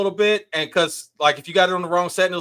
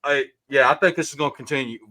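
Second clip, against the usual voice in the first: ordinary talk, nothing marked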